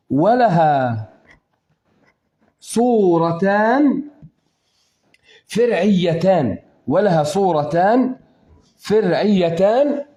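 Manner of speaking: slow, spaced dictation of the rule; slow teaching. Shown as a demonstration. Teacher to student, slow and spaced out. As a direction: lay each word down slowly for the class. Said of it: "teacher spelling rule out slowly".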